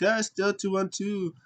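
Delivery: high, slow put-on retort; mock taunting reply. Imitating wrestlers trading jabs over numbers, mock taunt. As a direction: stretch it out teasingly.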